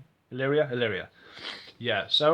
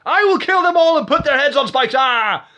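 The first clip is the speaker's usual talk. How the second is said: high, loud hammed-up war cry; over-the-top furious yell; enraged threat; 13 semitones higher, 13 dB louder than usual. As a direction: scream it like an unhinged tyrant. Acts out unhinged vengeful threat.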